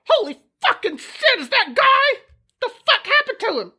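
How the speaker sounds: high, fast, rude squirrel; angry squirrel voice. Acted. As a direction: snarl it like a cranky squirrel.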